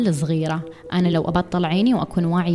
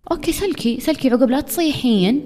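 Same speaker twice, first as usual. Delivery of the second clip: high-pitched mocking okay, fine then; sarcastic retort; 7 semitones higher, 3 dB louder than usual. Host mocks listener who keeps excusing people, sarcastic. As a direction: toss it back with scornful sarcasm.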